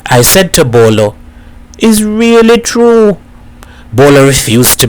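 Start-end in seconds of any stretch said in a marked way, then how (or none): 1.8-3.2 insistent. A narrator's reading. Boy insists, checked the club.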